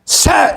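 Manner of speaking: high, sudden sound effect; imitated strike sound. Friend yanking a head down, imitated strike noise. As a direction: mimic the snap of a grab. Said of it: "and just went".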